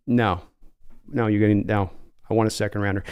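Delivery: lowkey John Schneider on the phone; low, weary team executive; calm Seattle GM. Acted. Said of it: "give John Schneider".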